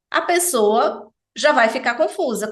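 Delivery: ordinary talk, nothing marked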